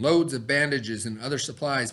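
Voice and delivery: ordinary talk, nothing marked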